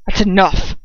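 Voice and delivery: sharp but controlled interjection; sharp command. A narrator's reading. Lucius stopping the humiliation of the singer, sharp command. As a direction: cut it short sharply, without raising the pitch. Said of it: "I hear Lucius say sharply".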